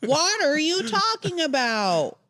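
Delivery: high, slow, stretched-out mock disbelief; milk the pun with slow, high-pitched indignation; mock outrage.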